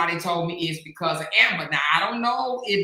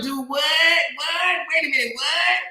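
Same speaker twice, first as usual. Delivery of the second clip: disbelief; 7 semitones higher than usual. Real, not acted. Host in high disbelief at the drama. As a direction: act like you can't believe it.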